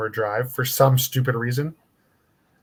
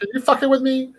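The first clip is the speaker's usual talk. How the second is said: higher, disbelieving mom voice; mock incredulous mom; 12 semitones higher than usual. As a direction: play the shocked mother. Imitating mom's disbelief.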